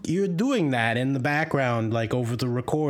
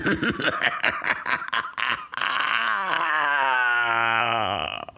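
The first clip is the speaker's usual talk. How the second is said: sinister demonic cackle; eerie villain laugh; evil cackle. Acted. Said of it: "weird cackling".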